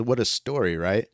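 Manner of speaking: usual speaking voice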